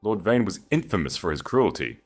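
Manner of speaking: plain reading voice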